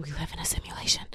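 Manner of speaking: fast whispered aside; whispered secret. Acted. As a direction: whisper it like a conspiracy, laughing. Jokey conspiracy aside.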